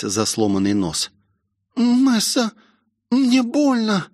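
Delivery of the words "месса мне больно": put-on high, plaintive girl's voice; high-pitched pained whimper; hurt girl. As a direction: complain of pain in a high voice. Injured Rosie complains of pain.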